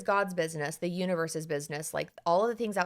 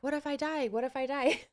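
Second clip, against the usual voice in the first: lightly voiced anxious inner voice, a bit higher; mock intrusive thought; 7 semitones higher than usual. Therapist voicing a client's intrusive thought, mildly mocking. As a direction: mimic the nagging worry in your head. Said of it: "intrusive thoughts like".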